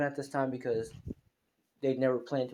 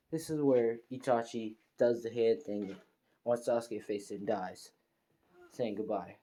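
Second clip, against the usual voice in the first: usual speaking voice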